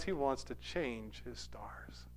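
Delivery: slow, hushed whisper. Retold or imitated. Storyteller hushing the key moment. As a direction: drop to a whisper for effect. Dramatic hush in retelling.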